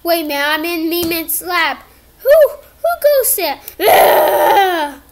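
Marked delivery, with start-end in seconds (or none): 2.2-5.0 much higher, louder challenging yell; alarmed shout. Acted. Character alarmed in the lab shouting at an intruder. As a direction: call out in alarm into the dark.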